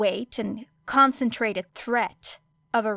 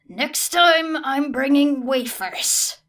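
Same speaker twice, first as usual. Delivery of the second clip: ordinary delivery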